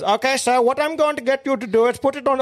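high, hammed-up Indian call-centre voice; mock Indian accent. Mate imitating the Indian scam caller, high mock accent. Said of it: "this fucking Indian bloke".

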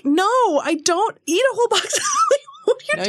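high-pitched mock outrage breaking into laughter; retold indignant comeback, cracking up; incredulous retort. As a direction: protest in disbelief, laughing as it rises.

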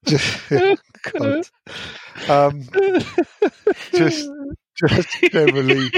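giggling through the words, pitch jumping up; laughing. Real, not acted. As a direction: laugh while trying to get the answer out. Fan laughing mid-answer.

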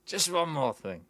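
lightly voiced TV detective; a hint of a rumpled detective's catchphrase; Peter Falk. Player answering a friend's challenge, lightly voiced TV detective. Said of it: "Do Peter Falk instead".

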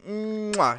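exaggerated smacked "mwah"; chef's kiss. Host praising the guest's best date, chef's kiss. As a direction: hum into a big kiss sound. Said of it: "chef kiss".

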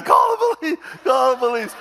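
very high, loud cry for help; mock screaming. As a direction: imitate a panicked man screaming. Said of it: "this guy's screaming".

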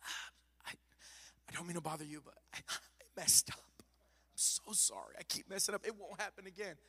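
hushed, whispered plea for forgiveness; softly ashamed confession; timid apology. Pretend. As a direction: tiptoe through an apology, afraid to wake him. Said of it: "and it's like".

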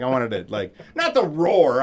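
usual speaking voice

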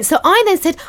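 normal talking voice

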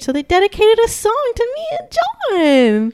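high-pitched, giddy delight; gushing with excitement; thrilled announcement. Real, not acted. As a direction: squeal the big news, voice way up. Thrilled climax of her story.

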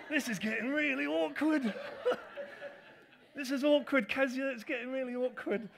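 cringing giggle at the awkward moment; telling Kezia the awkward bit while laughing. Real, not acted. Laughing at own awkward story.

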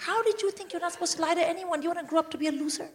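high, rapid telling-off; scolding mother. Acted. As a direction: rattle off an exasperated lecture at the child. Acted mother scolding her child.

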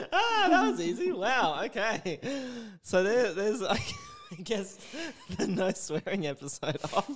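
loud, high, laughing crow; helpless loud amusement; gloating through laughter. Real, not acted. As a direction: crack up while rubbing it in. Gloating laughter after co-host swears.

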